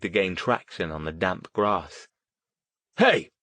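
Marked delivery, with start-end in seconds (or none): 3.0-3.3 high, loud call from afar; authoritative yell. A narrator's reading. Older man yells from the trees, authoritative loud call. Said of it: "yelled from the trees".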